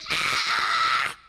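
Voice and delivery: ordinary delivery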